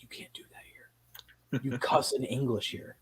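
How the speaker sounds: hushed, slow whisper of a mom scolding in public; mom's whispered warning. Retold or imitated. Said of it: "She's like".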